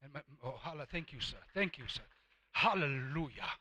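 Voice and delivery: ordinary talk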